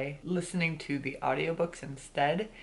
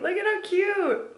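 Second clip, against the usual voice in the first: excited; 14 semitones higher, 4 dB louder than usual. Real, not acted. Showing off a knitted hat.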